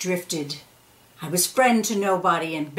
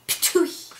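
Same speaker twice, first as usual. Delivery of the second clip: spitting sound; 8 semitones higher than usual. Acted. Being spat out.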